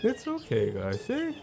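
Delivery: high, soft reassurance; calm the bird down gently; soothing pet voice. Real, not acted. To the nervous birds, a high soft hush.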